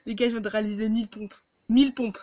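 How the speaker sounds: loud, elated announcement; excited. Real, not acted. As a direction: shout the news with joy. Announces thousand push ups done. (annonce euphorique)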